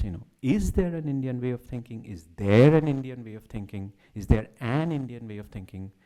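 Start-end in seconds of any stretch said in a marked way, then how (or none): 0.4-2.0 "Is" hit hard at the start; stress on IS. Shown as a demonstration. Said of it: "An emphasis on each of the words". 2.0-3.9 "there" punched out louder; stress on THERE. Shown as a demonstration. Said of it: "An emphasis on each of the words". 4.1-5.9 "an" said in full and leaned on; stress on AN. Shown as a demonstration. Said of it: "An emphasis on each of the words".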